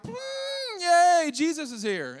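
flat half-hearted yay, then a jump up; mock a bored shrug of joy; unenthused yay. Preacher mocking a ho-hum Christmas, limp half-hearted cheer. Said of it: "it's not like".

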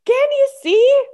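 very high, loud, stretched-out delight; hammed-up squeal of joy, drawn out; exaggerated excited part. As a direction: blow it up, high and gleeful. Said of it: "you can just exaggerate that".

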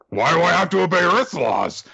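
high, loud alien whine. Acted. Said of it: "the alien says".

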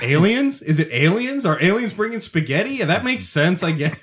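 mock bewildered. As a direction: act stunned as if aliens explain it. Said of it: "You're like".

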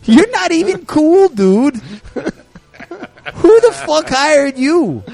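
high, loud, slow Jersey rant; angry Jersey guy. Pretend. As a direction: yell it like an outraged Jersey rocker.

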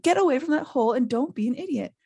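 exploding. A narrator's reading. Ron orders Neville away from the hole, exploding mildly. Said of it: "Ron exploded".

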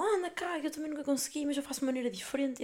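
lightly voiced helpful friend; friend's voice. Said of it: "E ela".

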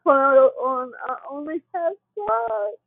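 usual speaking voice

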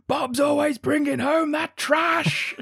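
high, loud, drawn-out mock screech; hammed-up outburst. Lucy complains to Norman about the doll, hammed-up high screech. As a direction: screech it slowly, hugely overacted. Acting Lucy's angry outburst.

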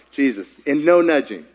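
ordinary delivery

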